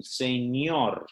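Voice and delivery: señor with the ñ said like the gn in mignon; ñ like French gn. Shown as a demonstration. Teacher shows students the ñ in señor, gn as in mignon. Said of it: "this sound here is like a G N in French".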